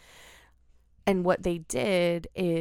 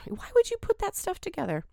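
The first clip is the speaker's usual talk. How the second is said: incredulous; 13 semitones higher, faster than usual. Real, not acted. Incredulous at mixing cyanide.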